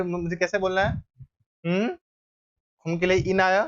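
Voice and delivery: ordinary talk, nothing marked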